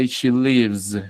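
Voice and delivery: slow "lives" with a drawn-out buzzing z; final S as Z. Shown as a demonstration. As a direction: stretch the voiced z ending "lives". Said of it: "la S suena como Z". ("lives" lento, con la S final como Z sonora)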